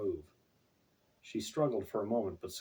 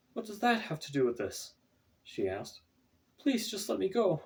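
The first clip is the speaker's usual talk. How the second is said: pleading Stacy; 10 semitones higher than usual. A narrator's reading. Stacy voiced, begging.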